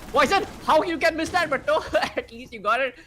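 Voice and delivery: high, loud outcry breaking into a laugh; incredulous shout. Real, not acted. Caster yelling how you can miss that, incredulous. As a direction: yell in disbelief at the play. Exclaims at a missed shot.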